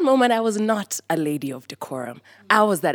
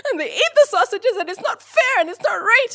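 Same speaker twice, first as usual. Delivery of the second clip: put-on much higher, wailing kid outrage; childish furious whine; 14 semitones higher than usual. Nine-year-old complaining to mother about stolen sausages, high outraged whine. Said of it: "in fits of rage and being so upset".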